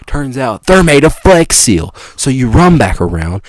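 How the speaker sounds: ordinary talk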